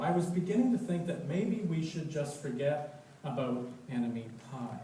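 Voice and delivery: plain reading voice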